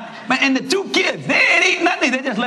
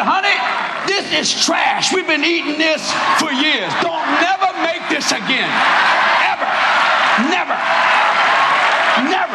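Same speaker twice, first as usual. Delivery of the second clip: husband complaining; 7 semitones higher than usual. Retold or imitated. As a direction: scold firmly, stressing never. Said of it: "He said".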